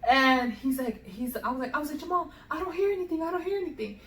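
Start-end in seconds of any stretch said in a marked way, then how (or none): 2.0-4.0 high, hurried worry; reenacted worried report to a husband; retold nervous alarm. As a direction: rush it out high, acting anxious. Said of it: "so, like, scared and nervous".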